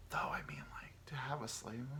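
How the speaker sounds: very soft, half-whispered musing; hushed guilty aside. Acted. Podcaster toying with a taboo thought, hushed aside. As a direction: let it slip out under your breath.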